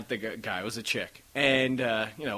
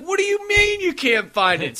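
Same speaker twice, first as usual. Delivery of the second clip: high-pitched, stretched-out disbelief; incredulous; 4 dB louder than usual. Retold or imitated. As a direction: retell it as an outraged, higher-pitched protest. Retelling disbelief at lost card.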